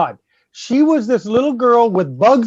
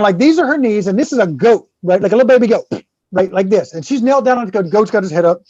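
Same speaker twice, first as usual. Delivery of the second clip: ordinary talk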